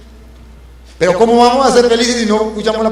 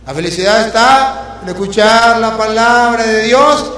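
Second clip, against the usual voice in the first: fiery preaching; 2 semitones higher than usual. Acted. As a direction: hammer the message home to the congregation. Preaching at full force.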